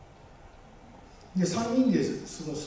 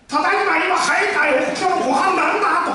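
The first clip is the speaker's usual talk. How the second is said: much higher and louder homecoming greeting; 一オクターブ上げて; 13 semitones higher, 10 dB louder than usual. Shown as a demonstration. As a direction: say it an octave up, full of energy. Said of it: "声を一オクターブ上げてちょっと喋ってみてください". (一オクターブ高い声で帰宅の挨拶)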